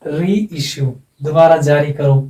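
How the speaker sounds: ordinary talk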